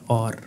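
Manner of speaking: और with a clear open diphthong, au-r; correct aur. Shown as a demonstration. Teacher models the right sound of और for students. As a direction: say और with the jaw pushed slightly forward. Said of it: "ये लफ्ज़ और होता है".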